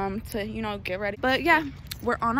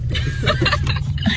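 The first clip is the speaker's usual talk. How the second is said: high giggle; 5 dB louder than usual. Real, not acted. Laughs at boyfriend.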